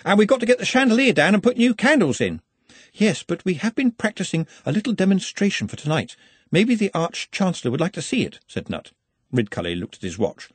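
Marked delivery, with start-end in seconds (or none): 0.0-2.4 high-pitched voice chipping in; helpful workman. A narrator's reading. 2.9-8.4 polite, eager assistant. A narrator's reading.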